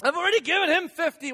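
high, loud exasperated protest; mock exasperated outburst; retold frustration. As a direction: act out being fed up and overwhelmed. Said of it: "where you got frustrated".

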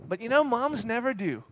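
ordinary talk, nothing marked

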